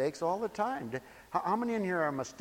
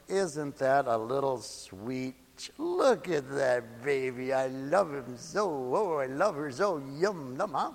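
put-on low, slow, adoring baby talk; doting parent cooing; 3 semitones lower, slower than usual. Parent gazing at a troublesome child at night, tender cooing.

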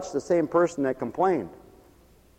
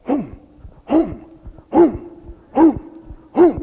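rising hurried hums; busy volunteer humming; humming rush; 9 semitones higher, much slower than usual. Retold or imitated. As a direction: hum along as you hand out bags. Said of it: "You know".

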